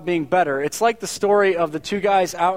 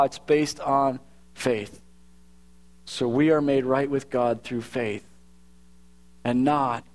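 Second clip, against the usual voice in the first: scripture reading; 5 semitones lower than usual. Acted. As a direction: read the passage gently and reverently. Reads scripture before preaching.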